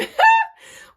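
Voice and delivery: loud, high burst of laughter; real laugh. Real, not acted. Storyteller recalls mom's drama with coach, laughing. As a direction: let out one sudden cackle.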